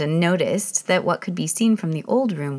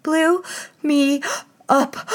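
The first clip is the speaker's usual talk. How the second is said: high, louder breathless gasps; panting; 10 semitones higher, 4 dB louder, much slower than usual. Acted. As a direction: gasp each word out of breath. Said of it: "panted the queen".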